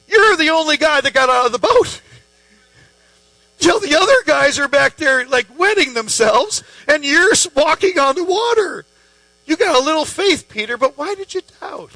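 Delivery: excited affirming. Pretend. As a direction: praise him like a proud coach. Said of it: "He doesn't say".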